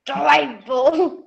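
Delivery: very loud lion. Acted. Children trying the lion's line together, loud lion voice. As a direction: roar the word out as a lion. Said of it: "say delightful like a lion".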